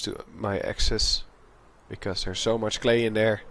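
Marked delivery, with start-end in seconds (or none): none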